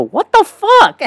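very high-pitched mock squeal; high, comic incredulous cry; shocked outburst. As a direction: squeak it in exaggerated disbelief. Acting out own shocked reaction.

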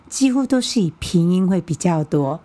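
usual speaking voice

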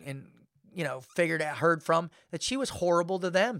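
normal talking voice

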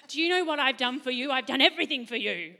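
half-hearted guilt-trip voice; mock resentful parent. Preacher voicing what the Father would never say, mock nagging. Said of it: "Never say, like".

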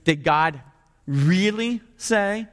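REALLY stretched slow and pitched high; stress on REALLY. Shown as a demonstration. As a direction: lean hard and long on REALLY. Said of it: "Let me highlight one word".

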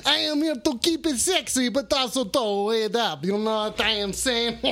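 hammed-up French host with mock chuckles; attempted French accent. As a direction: play a silly French radio host. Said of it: "I don't know how to do a French accent".

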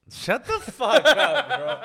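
high, loud, laughing outburst; laughing disbelief. Real, not acted. Friend hears an unlikely dunk boast, laughing disbelief. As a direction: drag it out through disbelieving laughter.

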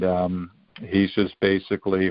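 ordinary delivery

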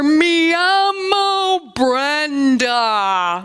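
very slow, drawn-out learner's Spanish; laboured phrasebook Spanish, played up; beginner Spanish. Shown as a demonstration. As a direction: stretch out "Me llamo Brenda" syllable by syllable. Demonstrating halting beginner Spanish.

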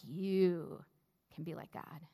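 the tempter stressing "You". Shown as a demonstration. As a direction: lean on "You", let "can be like God" fade. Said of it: "emphasizing different words each time".

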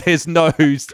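ordinary talk, nothing marked

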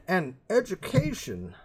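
high, slow wistful voice; mock nostalgic explainer. Dad explaining bookstores, high and slow. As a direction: drag it out like a fond old-timer. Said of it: "Why do they sound like".

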